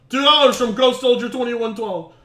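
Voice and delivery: usual speaking voice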